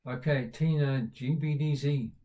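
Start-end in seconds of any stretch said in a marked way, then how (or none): 1.7-2.1 Z said the American way, "zee". Real, not acted. Host reading a viewer's name, American "zee" for Z. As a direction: say Z to rhyme with "tea". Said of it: "I can't stop speaking American".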